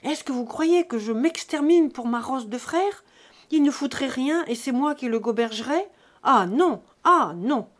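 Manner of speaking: heated, forceful refusal; indignant outburst. A narrator's reading. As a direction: protest at full voice, outraged. Son rages about brother and money.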